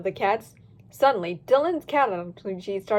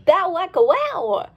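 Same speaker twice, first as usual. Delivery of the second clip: catchphrase cheer; 5 semitones higher, 6 dB louder, slower than usual. Acted. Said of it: "faster than you could say".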